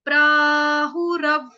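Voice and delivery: प्राहुरव stretched very slowly and loud, falling at the end; slow broken-up demo. Shown as a demonstration. Teacher showing how to read it in pieces, slowly. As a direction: draw out प्राहुरव like a chant. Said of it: "इसको तोड़ तोड़ के पढ़ लेंगे".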